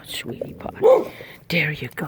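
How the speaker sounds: soft whispered coaxing. Acted. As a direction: whisper so the shy bird comes. Whispering to coax the bird.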